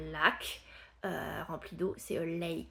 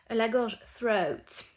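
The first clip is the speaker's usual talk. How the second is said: ordinary talk, nothing marked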